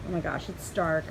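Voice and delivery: ordinary talk, nothing marked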